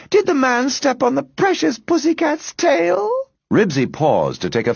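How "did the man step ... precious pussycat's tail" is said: put-on high baby-talk to a cat; cooing. A narrator's reading. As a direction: coo pityingly over a precious pet. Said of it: "she cooed".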